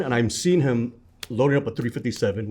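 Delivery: usual speaking voice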